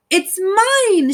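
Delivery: high shout of ownership. Acted. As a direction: shout it, claiming the cone. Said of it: "shouted Cyril".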